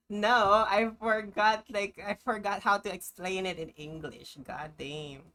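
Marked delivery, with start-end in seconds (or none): none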